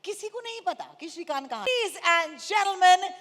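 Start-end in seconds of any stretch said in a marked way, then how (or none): 1.6-3.1 big stage introduction, louder, higher; announcer voice. Real, not acted. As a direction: announce the star to the crowd. Host introduces next guest.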